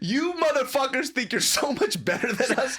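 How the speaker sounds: high, hammed-up motherly tirade; mom screaming. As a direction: imitate mom tearing into her sons, outraged. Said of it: "she starts screaming".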